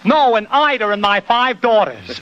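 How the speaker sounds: high comic tenor punchline. Shown as a demonstration. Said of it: "take [name] again".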